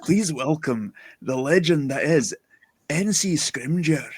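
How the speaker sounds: host intro in a slow put-on Scottish accent. Host welcoming guest in a Scottish accent. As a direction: roll it out like a proud Scottish announcer. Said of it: "She's allowed me to do this in a Scottish accent".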